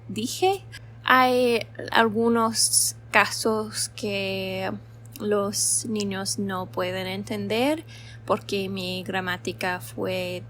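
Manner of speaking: ordinary talk, nothing marked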